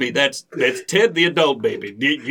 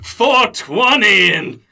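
preacher cry; 7 semitones higher, 5 dB louder, slower than usual. Acted.

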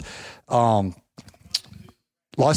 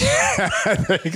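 laughing thanks; 6 semitones higher than usual. Real, not acted. Laughing at the host's joke.

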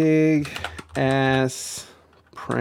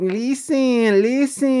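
higher, exaggerated Puerto Rican New York accent; mock Puerto Rican New York accent; 10 semitones higher, slower than usual. Streamer mocks the politician's put-on Puerto Rican New York accent. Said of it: "hardcore Puerto Rican, um, accent from New York".

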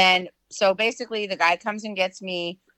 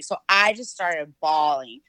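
usual speaking voice

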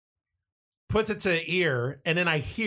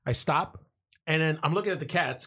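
ordinary talk

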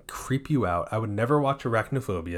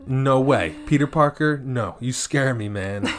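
loud, raised, fired-up no way; excited rant; 4 semitones higher, 6 dB louder than usual. Real, not acted. Host ranting about fearing Spider-Man, loud excited protest. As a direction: protest it loudly, half joking.

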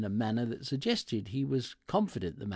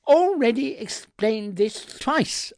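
loud, high-pitched exasperation; angry snap; 9 semitones higher, 8 dB louder than usual. A narrator's reading. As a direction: snap it at the assistant, out of patience. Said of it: "I snapped".

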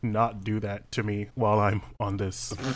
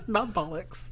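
laughing; 10 semitones higher, slower than usual. Real, not acted.

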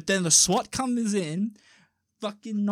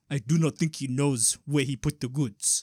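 low, put-on African accent; deep African voice; 5 semitones lower than usual. African criminal commenting on the newcomer, deep African accent. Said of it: "sounds like just this really deep voice".

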